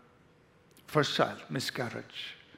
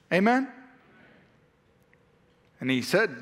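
ordinary talk

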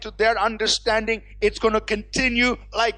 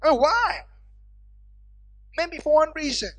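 raised, insistent pulpit delivery; higher-pitched, fervent sermon voice; impassioned preaching; 5 semitones higher, 5 dB louder than usual. Real, not acted. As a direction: press the big question on the congregation. Preaches at full force.

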